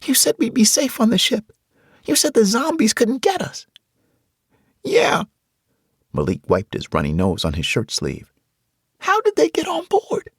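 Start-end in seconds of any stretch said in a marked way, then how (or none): 0.0-3.7 put-on high, hurt girl's voice; upset young girl. Tasha reminding adults of their promise, high and hurt. 4.8-5.2 put-on very high boy's voice; young boy voice. Malik agreeing with Tasha, high boy's voice. 9.0-10.3 put-on very high boy's voice; young boy voice. Malik agreeing with Tasha, high boy's voice.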